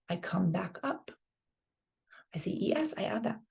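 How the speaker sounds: whispering the steps to herself; whispered. Acted. Teacher whispers the steps while working the chart. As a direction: murmur the steps under the breath. Narrating steps under breath.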